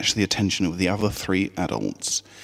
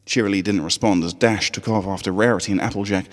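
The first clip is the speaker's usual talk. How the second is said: ordinary delivery, nothing marked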